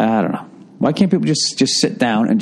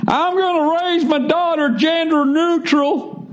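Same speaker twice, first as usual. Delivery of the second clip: high, slow mock-liberal voice; slower than usual. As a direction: parody the left as a conservative hears it. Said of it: "your viewpoint of what you think the right sounds like".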